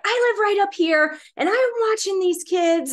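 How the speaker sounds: high, loud retold warning; cussing out. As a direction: imitate an angry neighbour staking his claim. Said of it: "basically cussing me out".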